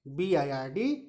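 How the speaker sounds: ordinary delivery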